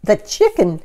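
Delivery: rising to high, loud panic; alarmed. Acted. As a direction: panic building on chicken. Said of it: "asked Missus Rogers".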